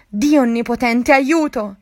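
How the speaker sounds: panicked prayer shouted out; terrified plea. Acted. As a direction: beg for help in sheer terror. Terrified cry at the beast.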